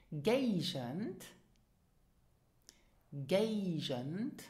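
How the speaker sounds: hard first g, zh for the second in "Gaugend"; pronunciation example. Shown as a demonstration. Teacher giving examples to repeat, careful "Gaugend". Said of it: "repeat after me".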